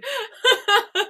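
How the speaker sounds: high, loud, laughing protest; laughing rant. Real, not acted. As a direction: crack up while protesting. Joking rant while cracking up.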